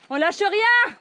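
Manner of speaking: rally everyone on; rallying shout. Real, not acted. Rallying cry on the climb.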